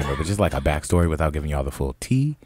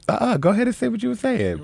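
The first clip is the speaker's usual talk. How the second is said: much higher, sassy dare, fast; retold sassy challenge; faster than usual. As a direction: throw the challenge back at her, half-acted. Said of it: "And I was just like".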